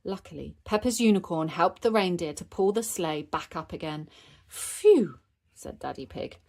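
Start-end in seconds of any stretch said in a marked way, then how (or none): 4.5-5.2 loud, high puff of relief; exaggerated sigh of relief; relieved phew. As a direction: let out a big phew after a scare. Daddy's sleigh is rescued.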